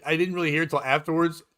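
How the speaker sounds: normal talking voice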